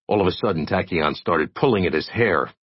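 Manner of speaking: usual speaking voice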